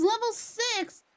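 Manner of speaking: high, slow, drawn-out hype call; excited shout. Real, not acted. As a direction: announce it like a big win. Hype train level announcement.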